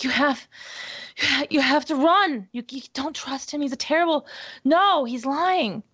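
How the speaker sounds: panting and yelling at mother to run; frantic screaming. Acted. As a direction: scream a warning until the voice gives out. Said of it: "screaming to the point my voice gives out".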